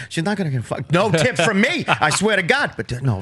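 ordinary delivery

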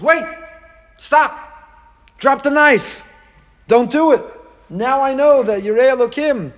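loud, high cry to stop; urgent shout. Acted. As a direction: call out to halt him. Angel calls to stop Avram.

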